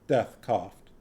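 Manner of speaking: ordinary delivery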